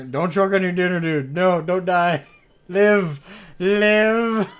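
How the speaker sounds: loud, high hammed-up plea; mock pleading. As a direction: overact begging him to live. Teasing friend who choked.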